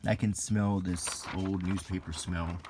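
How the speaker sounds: normal talking voice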